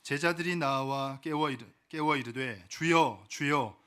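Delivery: solemn, measured Bible reading; scripture reading. Acted. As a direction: read the passage out with reverent, drawn-out phrasing. Reads scripture aloud.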